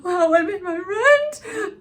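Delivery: hammed-up teary worker voice; mock tearful worker. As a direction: sob it out in mock relief.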